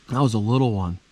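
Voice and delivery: ordinary talk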